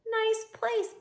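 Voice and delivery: high, light little dog voice; Bob's voice. Acted. Bob the dog greets Ivan, high-pitched and light. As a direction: chirp it like a small cheeky dog. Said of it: "Bob says".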